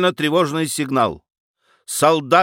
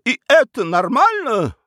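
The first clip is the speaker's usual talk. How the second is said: much higher, indignant question; indignant outcry; 10 semitones higher than usual. Acted. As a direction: fling the question at the room. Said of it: "выкрикнула баронесса". (возмущённый выкрик, высоко)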